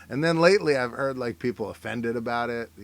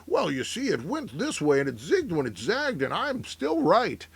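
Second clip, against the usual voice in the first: mock weatherman with swooping, singsong pitch; weatherman making excuses; 4 semitones higher than usual. Weatherman defending a wrong forecast, mock singsong excuse. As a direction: wheedle like a forecaster explaining away a miss. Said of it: "And they're like".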